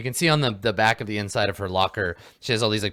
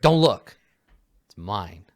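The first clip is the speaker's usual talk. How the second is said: snapping; 8 dB louder, slower than usual. Acted. Dealer catching someone peeking at her phone, snapping. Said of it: "she snaps at you".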